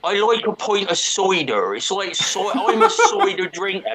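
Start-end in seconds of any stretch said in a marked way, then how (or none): 0.0-1.8 put-on West Country farmer accent, pitch up, a bit piratey; lightly done West Country farmer accent. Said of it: "it's a bit piratey". 2.1-3.9 put-on laughing through a raised West Country farmer accent. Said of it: "That's how they talk".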